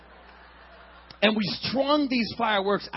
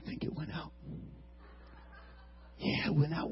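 hushed; 4 semitones lower, 12 dB quieter than usual. Retold or imitated. Whispering near the fuse.

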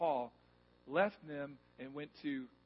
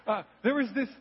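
ordinary delivery

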